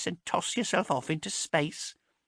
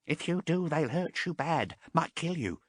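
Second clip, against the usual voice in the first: fearful warning; 10 semitones higher than usual. A narrator's reading. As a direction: warn her with dread. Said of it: "he said fearfully".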